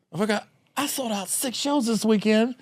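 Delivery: high, hammed-up defiant drunk comic; a hint of a stubborn Ralphie May digging in. Ralphie telling the manager he's staying all night, high and hammy.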